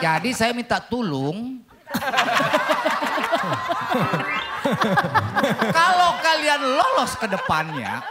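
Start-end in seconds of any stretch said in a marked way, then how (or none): 1.9-5.6 long rolling laugh; laughter. Real, not acted. Judge laughing after his own pun on tulung, long laugh. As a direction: laugh on at length, pitched a little up.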